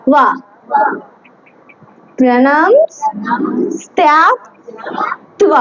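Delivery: প্রণাম স্থ্যাক ত্ব said piece by piece with pauses; প্রণাম স্থ্যাক ত্ব ভেঙে ভেঙে বলা; broken up slowly. Shown as a demonstration. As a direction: chop প্রণাম স্থ্যাক ত্ব into slow separate parts. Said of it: "আবার একটু ভেঙে ভেঙে বলি".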